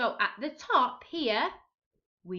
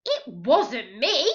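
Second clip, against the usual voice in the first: slow, louder protest; crying out; 4 semitones higher, 8 dB louder, slower than usual. A narrator's reading. Pete protests to the angry captain, slow loud cry. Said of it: "cried Pete".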